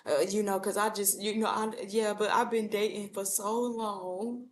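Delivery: imitating a gloomy single complaining; mopey Eeyore. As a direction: moan like nothing will work out. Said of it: "Here you go with the Eeyore syndrome".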